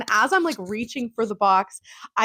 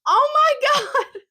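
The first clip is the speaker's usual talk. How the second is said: much higher, laughing squeal; giggly high oh my God, louder; delighted oh my god; 4 dB louder than usual. Retold or imitated. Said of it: "I was like".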